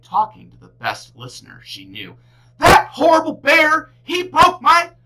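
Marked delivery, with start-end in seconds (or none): 2.6-4.9 high loud angry whine; upset complaint. A narrator's reading. Girl complaining loudly to her stuffed animal. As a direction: tell the stuffy angrily.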